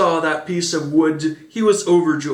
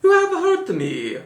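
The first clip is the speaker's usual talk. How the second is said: put-on very high Italian-accented yelp of pain; a hammed-up ow from a little puppet voice; very high, mock-pained puppet; high cry of pain. A narrator's reading. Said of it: "cried the little voice, as if in pain".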